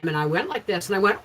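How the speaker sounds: ordinary talk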